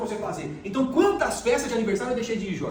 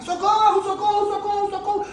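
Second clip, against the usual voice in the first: high, loud shouts of Socorro, imitating the panic; retold cry for help; 11 semitones higher, 5 dB louder than usual. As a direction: reenact yelling for help in the sea, much higher. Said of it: "comecei a gritar". (gritos de socorro encenados, bem agudos e altos)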